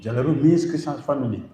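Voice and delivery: ordinary delivery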